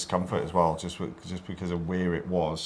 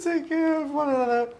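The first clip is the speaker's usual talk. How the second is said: laughing. Real, not acted. Laughing through sign-off.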